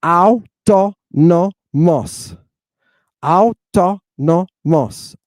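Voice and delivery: "Autonomos" split into four syllables, au-to-no-mos; slow, evenly separated syllables on "Autonomos"; Greek pronunciation. Shown as a demonstration. Teacher sounding out Greek for students, "Autonomos" syllable by syllable. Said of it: "Do you hear autonomous in that".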